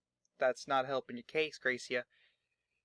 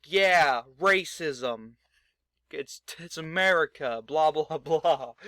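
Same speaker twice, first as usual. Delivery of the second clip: louder sneering blah blah; mock trash talk; 7 dB louder, slower than usual. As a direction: brush it off with a laugh. Mocking Enzo's trash talk.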